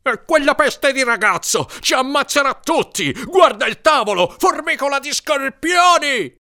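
high, exasperated shouting; furious outburst. A narrator's reading. Larry blames the boy as scorpions swarm, shouting furiously.

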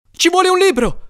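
high, urgent yell; Leslie yelling. A narrator's reading. Brother calls for a book against scorpions, yelling. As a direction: yell for a weapon in the uproar. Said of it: "urlava Leslie".

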